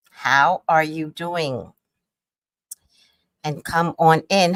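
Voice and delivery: normal talking voice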